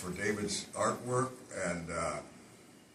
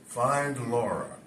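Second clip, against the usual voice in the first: slow, drawn-out "Find Laura."; plain forward line; 2 semitones higher, 5 dB louder, much slower than usual. Shown as a demonstration. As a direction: say "Find Laura." slowly and clearly. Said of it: "I had to say it backwards".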